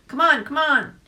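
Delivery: loud, high, urgent dog call; barking dog voice. A narrator's reading. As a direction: bark it out like an eager hound. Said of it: "barked the first dog".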